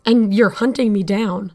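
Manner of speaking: building angry accusation. Acted. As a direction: let the anger build to the end.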